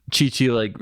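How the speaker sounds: normal talking voice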